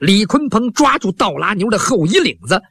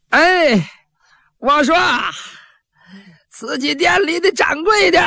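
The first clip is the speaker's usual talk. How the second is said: high, strained shout across the street; calling out loud; 10 semitones higher, slower than usual. Acted. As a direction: yell it up at the shop door. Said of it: "你扯开嗓子喊".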